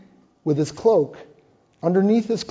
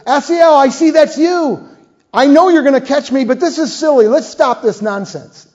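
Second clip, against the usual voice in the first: higher and louder, calling back while running; a fleeing commander calling to his pursuer, higher and louder; Abner calling back; 7 semitones higher, 6 dB louder than usual. Acted. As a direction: shout it back over your shoulder to the runner behind. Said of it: "Abner leans over his shoulder and says".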